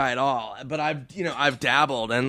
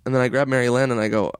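normal talking voice

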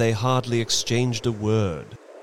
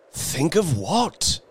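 slow, higher, baffled question; 8 semitones higher, slower than usual. A narrator's reading. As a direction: ask it bewildered, drawn out. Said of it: "he questioned".

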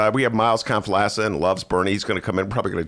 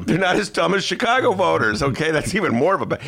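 ordinary talk

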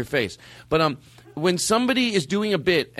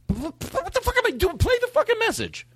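exasperated outburst. Real, not acted. Host exasperated at himself on air.

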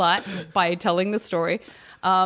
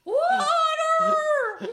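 long, drawn-out falsetto cry; imitated scream, held very high in falsetto; much slower than usual. Imitating a stranger screaming for water, drawn-out falsetto scream. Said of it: "non-female voice in falsetto".

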